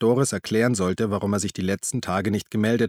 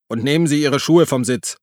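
a loud, higher, curt command; brusque order; 5 semitones higher, 6 dB louder than usual. A narrator's reading. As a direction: order him like a stern official. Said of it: "befahl der Schaffner in barschem Ton". (barscher Befehlston)

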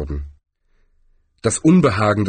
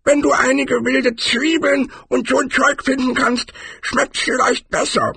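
very high-pitched barbarian character voice; high character voice, far from the narrator's; Cohen. A narrator's reading. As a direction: squeak it out high, explaining troll habits. Cohen goes on about food.

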